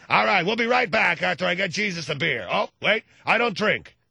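lightly voiced talk show host; a hint of Larry King's on-air voice. Imitating Larry King on air.

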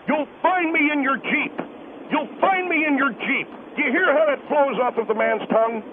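ordinary talk, nothing marked

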